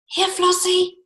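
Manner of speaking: put-on high, soft boy's call; boy calling his lamb quietly near a dragon; boy calling softly. A narrator's reading. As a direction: coax the lamb gently in a boy's voice. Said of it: "the boy called softly".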